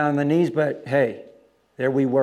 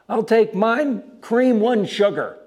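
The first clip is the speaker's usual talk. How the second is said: raised, high retold call; loud, pitched-up shout across a crowd; 9 semitones higher, 6 dB louder, slower than usual. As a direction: call an order after someone leaving. Calling order after departing man.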